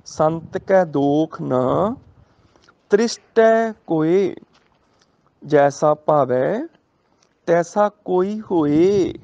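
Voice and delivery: slow, measured scripture recital; gurbani recitation. Shown as a demonstration. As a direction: draw each word out reverently. Recites gurbani line slowly. (ਹੌਲੀ ਪਾਠ ਦੀ ਲੈਅ)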